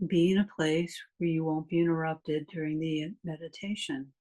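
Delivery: calm meditation voice with long pauses; guided meditation. Real, not acted. Meditation teacher leading a class through a body scan, calm guided meditation. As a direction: guide them unhurriedly, leaving long silences between phrases.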